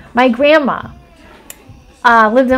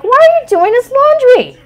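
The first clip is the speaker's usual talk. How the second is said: incredulous; 12 semitones higher, 4 dB louder than usual. Retold or imitated. Retelling her own reply to grandma.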